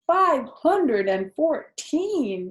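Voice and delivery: high, slow astonished repeat of the number; amazed disbelief. A narrator's reading. Pig astonished at the spider's egg count, high and slow. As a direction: gasp it out in wonder.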